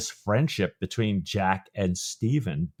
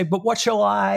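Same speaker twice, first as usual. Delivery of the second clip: happy eager start; 10 semitones higher than usual. Acted. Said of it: "He starts to say".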